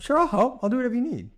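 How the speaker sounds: high chipper yes-man; eager spouse. Retold or imitated. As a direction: play the clueless eager husband. Acted cheerful spouse reply.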